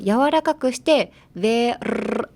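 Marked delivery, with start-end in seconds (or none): 1.3-2.3 "ベルルル" with the ルルル as a trilled R; tongue-rolled R. Shown as a demonstration. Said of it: "タングロールをして、柔らかくして".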